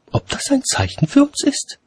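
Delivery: high lady voice with a smile; meaningful hint. A narrator's reading. Lady hinting at a sign to watchman, meaningful high voice. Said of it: "bedachte Mumm mit einem bedeutungsvollen Lächeln".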